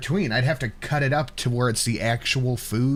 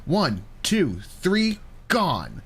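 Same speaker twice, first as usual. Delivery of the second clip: excited higher play-by-play call; sports announcer style; 3 semitones higher than usual. Pretend. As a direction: call the bite like live sports. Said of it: "sports announcer style".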